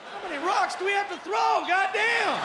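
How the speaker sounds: very high, loud yell; high-pitched, fed-up hollering; exasperated outburst. Acted. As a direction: shout it in comic outrage. Exasperated outburst at being unknown.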